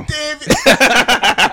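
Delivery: high, slow, drawn-out laughing name. Real, not acted. Mocking a friend's real name, laughing. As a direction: stretch the name out while laughing.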